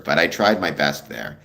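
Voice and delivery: normal talking voice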